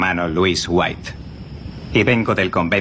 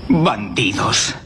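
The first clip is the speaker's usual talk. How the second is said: slow accusation; much slower than usual. Acted. Monk accuses the bandits.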